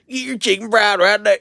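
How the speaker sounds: loud, high, exaggerated Southwestern US twang; deep Southwest accent. Shown as a demonstration. Speaker mimicking a Southwesterner on fried chicken, deep Southwest accent. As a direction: holler it in a thick Southwest US accent. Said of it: "way deep. They talk like that".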